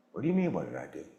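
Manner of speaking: low, quiet aside as the confused other person; puzzled listener. Retold or imitated. Voices puzzled listener's reply in retold dialogue.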